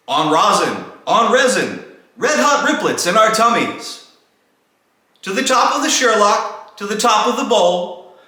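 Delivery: high, loud calls to the team; shouting names. Acted. Saint Toke shouting his reindeer names from the roof, high and loud. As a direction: bellow out each name like a sleigh driver. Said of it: "he whistled and shouted and called them by name".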